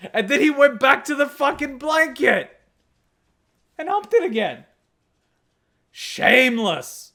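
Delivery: high, loud incredulous exclaiming; loud, high-pitched comic indignation; mock outrage. Real, not acted. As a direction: cry out at the dog's scandal. Mock outrage at the dog story.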